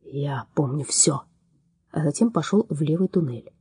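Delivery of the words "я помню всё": slow hateful whisper. A narrator's reading. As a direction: whisper it slowly, with hate. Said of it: "прошептал".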